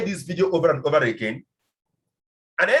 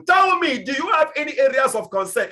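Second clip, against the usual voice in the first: higher and louder, eagerly pleading; eager begging; 7 semitones higher, 5 dB louder than usual. Retold or imitated. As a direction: beg them to ask, keen. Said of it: "I will be begging people".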